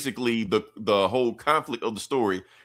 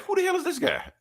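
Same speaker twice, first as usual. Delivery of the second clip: much higher, squeaky startled voice; mock startled Cyborg. Cyborg spotting Static in the base, high startled yelp. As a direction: yelp it out like you just spotted an intruder.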